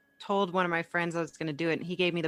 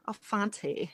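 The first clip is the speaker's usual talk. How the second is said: mock French accent, nasal vowel; 2 semitones higher, 8 dB quieter than usual. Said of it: "Maybe it sounds better with an accent".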